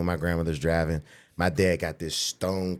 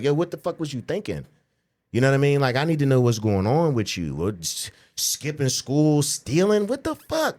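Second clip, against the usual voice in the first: dad's scolding talk; 6 semitones higher than usual. Retold or imitated. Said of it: "He just give me the talk".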